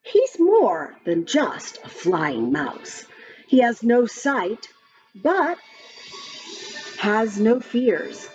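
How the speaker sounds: usual speaking voice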